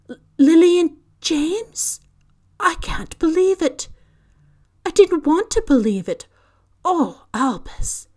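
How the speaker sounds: high, loud, cracking with shock; stricken disbelief. A narrator's reading. McGonagall hears the Potters are dead, stricken cry. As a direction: she can barely take the news in. Stunned grief at the news.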